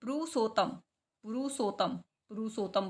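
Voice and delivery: पुरुषोत्तम as "pu-ru-so-tam"; long "so", single t in पुरुषोत्तम; single-ta mispronunciation. Shown as a demonstration. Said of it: "तय को हटा देंगे तो क्या बन जाएगा?". (पुरुषोत्तम को "पुरुसोतम" बोलना)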